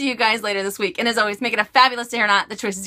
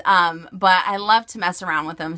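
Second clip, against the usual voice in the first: usual speaking voice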